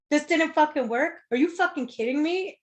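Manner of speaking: high, incredulous outburst retold; pissed off. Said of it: "she's fucking pissed".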